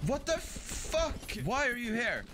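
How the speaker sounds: shocked. Real, not acted. Monster appears unexpectedly.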